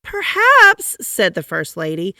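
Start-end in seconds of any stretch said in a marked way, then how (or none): 0.0-1.0 very high, lilting "perhaps"; musing wife. A narrator's reading. First Lady musing over her stuck husband. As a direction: drift off thoughtfully, high.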